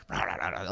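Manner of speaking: rolling low gibberish; imitated babbling. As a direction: imitate a man babbling to himself. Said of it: "babbling up the alley".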